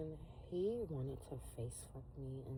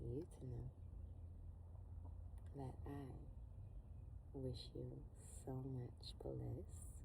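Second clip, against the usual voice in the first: soft tarot blessing; 4 semitones lower, 8 dB quieter than usual. Acted. Gentle tarot blessing read.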